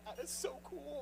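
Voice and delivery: high, soft character voice. Retold or imitated. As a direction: switch into a cartoon voice. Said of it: "instantly go into that character".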